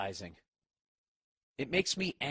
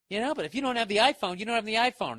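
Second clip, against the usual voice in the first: louder, fast sneering ad line; loud mock ad voice; 8 semitones higher, 6 dB louder, faster than usual. Mocking the iPhone ad slogan, loud and fast. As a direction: spit out the slogan in mockery. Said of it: "na na na na na fucking cunty shit".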